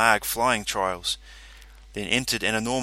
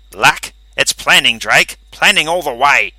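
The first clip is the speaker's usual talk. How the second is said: smug character voice; 4 semitones higher, 12 dB louder than usual. Acted. Calverley dismisses luck.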